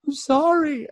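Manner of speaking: very high squeaky apology; mock apology. Father apologizing to imagined daughter, high and squeaky. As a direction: squeak out a sheepish sorry.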